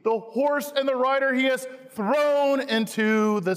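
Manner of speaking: lightly lifted, joyful proclamation; a hint of triumphant singing; Miriam's victory song. Preacher voicing Miriam's victory song, lightly lifted. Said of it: "Miriam and the women sing".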